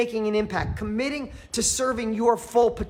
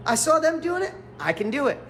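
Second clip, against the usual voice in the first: ordinary talk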